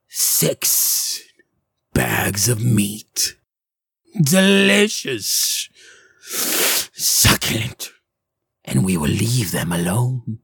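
slow, whispery, savouring hag; hungry hag. Acted. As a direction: relish each word like a treat.

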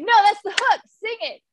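high, insistent mock yell; retold excited yelling. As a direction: urge the singer on in a high voice. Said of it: "and I'm yelling".

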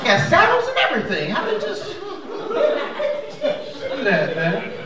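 high squeaky mock voice of a homeless rider; homeless guy impression. Comic teller voicing a homeless man marveling at deer riders, high and squeaky. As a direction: pitch it way up like an amazed street guy.

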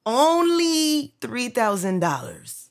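high, drawn-out mocking echo; mock nonchalance. Guest echoing the scammers' price, slow high-pitched mockery. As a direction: stretch it slowly in a high mocking voice.

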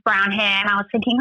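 usual speaking voice

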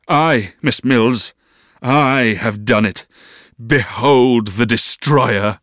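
slow, declaimed self-accusation; melodramatic confession. A narrator's reading. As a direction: proclaim your guilt grandly, drawing out words.

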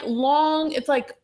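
normal talking voice

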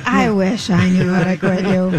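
low, slow cowboy voice; manly voice. Acted. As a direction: deepen it into a rugged cowboy drawl. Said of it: "That was my manly voice".

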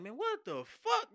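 high, slow drawn-out disbelief; retold shock. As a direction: stretch it out in stunned shock. Retells door beaten down.

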